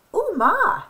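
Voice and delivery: high, surprised "oh, ma"; surprised exclaim. A narrator's reading. Hen exclaims at what the feather can do, surprised. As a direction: gasp it out in wonder. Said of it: "Hen exclaimed".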